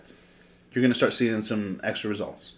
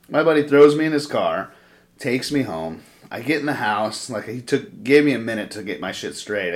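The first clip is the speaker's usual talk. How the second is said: ordinary delivery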